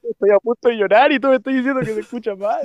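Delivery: protesta teatral, aguda y fuerte; queja exagerada en broma. Pretend. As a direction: reclamo melodramático en broma. Reclama dramatizando que se escucha mal. (queja dramática y chistosa)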